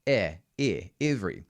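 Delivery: "Air, air, every" with "air" kept narrow; shaded vowel air. Shown as a demonstration. Coach drilling the closed "air" vowel. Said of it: "You can hear me singing".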